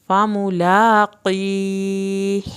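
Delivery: "فَمُلَاقِيهِ" slow, laa stretched, final ha breathed; drawn-out Quranic recitation; recitation demo. Shown as a demonstration. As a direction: recite "فَمُلَاقِيهِ" carefully and slowly. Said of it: "یہاں پہ اخوا ہو رہا ہے".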